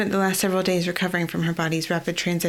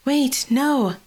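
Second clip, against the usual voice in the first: sharp, higher, louder cry of protest; alarmed exclamation; 8 semitones higher, 4 dB louder than usual. Acted. Genevieve protesting to Ophelia, alarmed higher cry. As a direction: blurt it out to stop her. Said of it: "Genevieve exclaimed".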